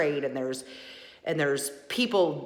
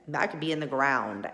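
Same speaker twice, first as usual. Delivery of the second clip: normal talking voice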